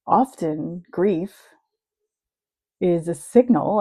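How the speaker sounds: usual speaking voice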